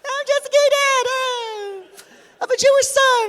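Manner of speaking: very high, stretched-out squeal; gleeful over-the-top falsetto; hammed-up crowing. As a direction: play the triumphant dad at an extreme high pitch. Dad gloating at stunned other dads.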